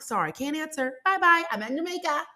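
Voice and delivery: high, breezy mock brush-off; sassy brush-off. Brushing off coworkers from Jamaica, high breezy sass. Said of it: "I'm like".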